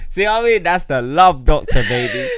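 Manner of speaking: high, loud laughing boast. Real, not acted. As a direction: crow it out while laughing. Laughing after love doctor skit.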